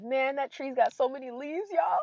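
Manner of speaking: a mock teary wonder at all the leaves; awed at a tree. Telling viewers how she cries at trees, in mock awe. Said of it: "sometimes I look at trees and I'm like".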